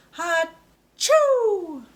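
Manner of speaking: loud, high mock sneeze; big sneeze. Shown as a demonstration. George the piglet sneezes in a story read to a child, loud. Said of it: "One more time".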